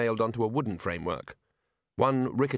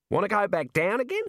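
put-on hearty old wizard; 10 semitones higher than usual. Arch-Chancellor teasing.

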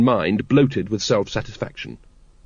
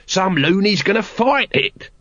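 high, slow, drawn-out character voice; Nobby's character voice; 7 semitones higher than usual. A narrator's reading. Nobby to Colon about someone fighting the dragon, high drawn-out voice. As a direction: stretch it out, pitched well up.

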